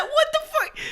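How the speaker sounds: high squealing laugh into a swear; laughing high-pitched what the; laughing disbelief. Real, not acted. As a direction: crack up in shocked disbelief. Shocked at AI image result.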